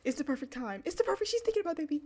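high, loud, racing excitement; freaking out. Real, not acted. Player spots the perfect time for baby news, freaking out. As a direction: blurt it fast in a happy panic. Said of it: "Y'all, I'm freaking out".

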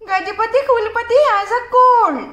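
high, loud giggling gossip; laughing gossip. Acted. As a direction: giggle through the news. Flower seller gossips laughing.

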